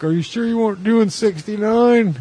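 cop in the joke. Retold or imitated. The cop pulling over the couple in the punchline, high put-on voice. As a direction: play the officer deadpan but squeaky. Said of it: "And he's like".

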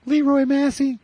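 put-on very high, squeaky boy's voice; Randy's high voice. Randy bragging about his dad, squeaky high boy voice. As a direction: brag like a kid whose voice hasn't dropped. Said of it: "He had a high voice".

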